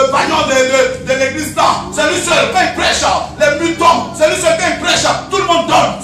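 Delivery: loud, rhythmic preacher's refrain; preaching at full force. Acted. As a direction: thunder it at the flock. Fiery warning about hell.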